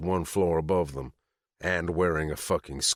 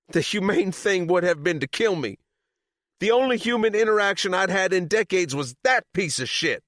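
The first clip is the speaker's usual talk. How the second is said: high, loud bitter rage; bitter fury; 6 dB louder than usual. A narrator's reading. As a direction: spit the words out. Sadie raging at Chop.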